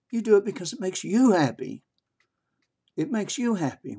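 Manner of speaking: "you" pitched high and drawn in "makes you happy"; stress on YOU. Real, not acted. Speaker to listeners on why you give, stressed "you". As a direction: lean high on "you", driving the point home. Insisting on YOU against them.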